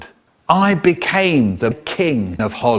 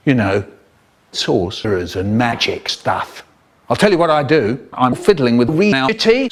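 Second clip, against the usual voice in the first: normal talking voice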